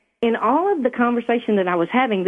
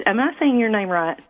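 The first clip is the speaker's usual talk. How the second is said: ordinary talk, nothing marked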